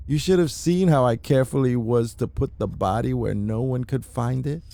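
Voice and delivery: ordinary talk, nothing marked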